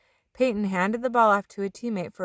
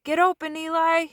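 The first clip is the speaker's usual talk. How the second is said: high shout to a receiver; 9 semitones higher, 3 dB louder than usual. A narrator's reading. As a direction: call him open, loud. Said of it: "he shouted".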